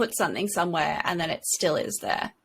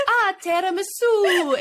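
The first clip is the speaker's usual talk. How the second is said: high, slow sweet lilt on tiramisu; sing-song code word; 11 semitones higher, 3 dB louder than usual. Pretend. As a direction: coo it like a fake-calm mother. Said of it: "And if I say, like".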